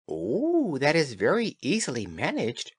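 high light fairy voice; tiny tinkling fairy voice. Acted. Fairy answers the woman.

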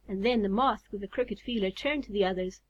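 usual speaking voice

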